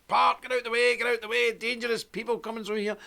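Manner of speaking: high, monotone call to move people aside; soldiers clearing a crowd. Retold or imitated. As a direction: mimic an escort calling a path through the crowd. Soldiers clearing airport crowd for him.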